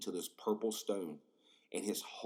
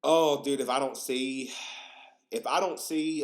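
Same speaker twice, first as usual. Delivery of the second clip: excited outburst; 4 semitones higher, 6 dB louder than usual. Real, not acted. Excited outburst about sad episode.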